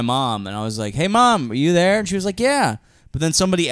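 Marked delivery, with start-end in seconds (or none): 0.9-2.0 higher, louder call to mom. Retold or imitated. Calling out to mom in dream. 2.4-2.8 much higher short reply as mom; a high mom answer; mom's voice. Retold or imitated. Mom answers in dream retelling.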